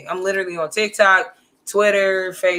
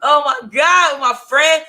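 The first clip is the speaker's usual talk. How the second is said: retold dismay; 6 semitones higher, 5 dB louder, much slower than usual. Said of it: "so this is just like".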